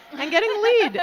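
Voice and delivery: sudden high, loud burst; excited. Real, not acted. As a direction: call it out, thrilled. Announcing the lead jammer.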